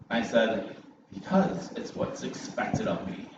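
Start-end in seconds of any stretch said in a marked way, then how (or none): 1.1-3.3 higher, breathy mock defense; mock earnest. Husband defending himself to his wife in a higher, breathy mock earnest voice. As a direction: plead it like duty calls.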